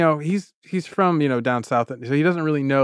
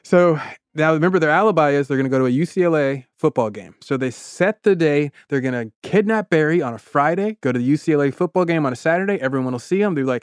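ordinary delivery

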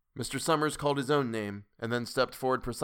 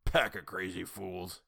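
put-on grumpy old man; slower than usual. A narrator's reading. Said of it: "Old Man Warner snorted".

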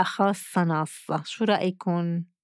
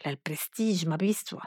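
whisper; 3 semitones lower, 8 dB quieter than usual. Retold or imitated. Grandma's aside about her hair.

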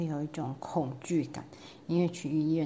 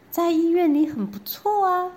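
coaxing doctor; 10 semitones higher, 7 dB louder than usual. Acted.

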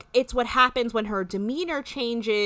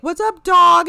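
much higher, louder mock greeting; mock hype greeting; 9 semitones higher, 11 dB louder, slower than usual. Acting out their weird back-and-forth, hammed-up hype. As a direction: imitate an over-hyped, shouted hello.

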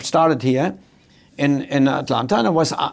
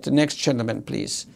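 gentle call; 4 semitones lower, 6 dB quieter than usual. Retold or imitated. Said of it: "She could have said".

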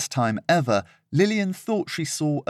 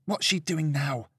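mumbled under breath; 4 semitones higher, 6 dB quieter than usual. Acted. Lillian muttering about Serena, under her breath. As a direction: grumble it under your breath. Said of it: "mumbled under her breath".

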